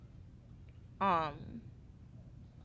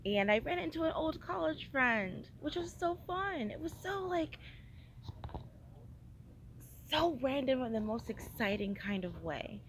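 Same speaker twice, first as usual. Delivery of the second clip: bubbly and much higher; excited; 7 semitones higher than usual. Real, not acted. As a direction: pitch jumps up with excitement. Excited about meeting old friend.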